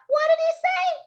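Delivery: very high squealed question; high-pitched girlish call; girls yelling. A narrator's reading. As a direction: shriek it like little girls. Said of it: "The girls yelled out".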